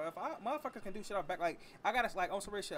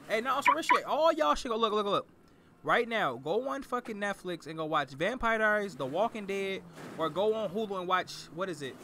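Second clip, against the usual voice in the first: loud; 10 dB louder than usual. Real, not acted. Shouting recommendations to all viewers.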